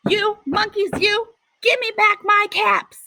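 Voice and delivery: high, exasperated shout; peddler shouting. Acted. A very angry peddler shouts at the monkeys, high. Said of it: "he shouted".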